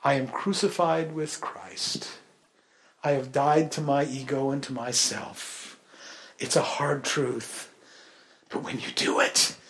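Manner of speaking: hushed, slow sincerity; quiet solemn. Acted. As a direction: drop the voice to a hushed confession. Solemn confession after the story.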